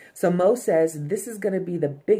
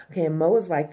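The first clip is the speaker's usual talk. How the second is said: usual speaking voice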